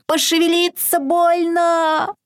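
high, slow piteous moan; whining piglet. A narrator's reading. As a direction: groan like a lazy piglet. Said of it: "похрюкивает жалобно".

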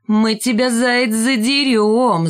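slow, drawn-out menacing howl; hammed-up playground yell, stretched out; mock yell. A narrator's reading. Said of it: "завопил лохматый".

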